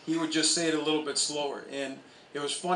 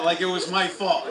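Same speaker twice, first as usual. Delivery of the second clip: ordinary delivery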